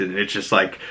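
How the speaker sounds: ordinary talk, nothing marked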